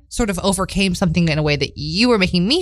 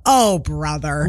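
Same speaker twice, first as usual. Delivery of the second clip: exasperated sigh; 2 semitones lower, much slower than usual. Retold or imitated. Exasperated retort to date.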